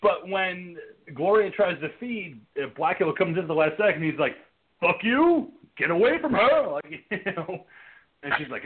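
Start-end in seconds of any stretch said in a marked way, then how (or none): 4.8-6.7 high-pitched indignant yell, imitated; hammed-up warding-off shout; mock angry outburst. Host as Blackula defending Lisa from Gloria, mock fury.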